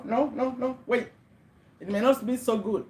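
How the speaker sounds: ordinary talk, nothing marked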